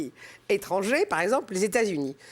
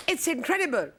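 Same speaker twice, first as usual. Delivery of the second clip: high, put-on American English accent; 7 semitones higher than usual. Guest quoting a Los Angeles taxi driver, in an American English accent. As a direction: imitate an amazed American in English. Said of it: "Quel accent anglais!".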